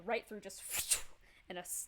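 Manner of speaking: ordinary delivery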